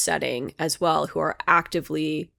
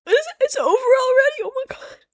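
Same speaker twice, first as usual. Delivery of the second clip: tearful pleading, much higher, sobbing between words; crying. Real, not acted. Comforting Violet while watching, real tearful high crying. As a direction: cry it out, begging the show to stop. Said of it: "I'm just gonna cry through the outro".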